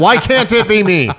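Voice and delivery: high, drawn-out comic wail with laughter; hammed-up jealous plea; mock lament. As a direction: wail it slowly in mock envy. Retold envious thought, hammed up.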